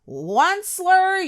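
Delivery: high, loud croaked cry of the name; croaking cry. A narrator's reading. Lorax cries out to the Onceler, high and loud. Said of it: "he cried with a cruffulous croak".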